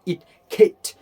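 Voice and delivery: "खिट" with short i and aspirated k; ending demo. Shown as a demonstration. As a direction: snap out "खिट" as a short, loud syllable. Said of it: "उसको खिट बनना". ("खिट" छोटी इ के साथ, ज़ोर से)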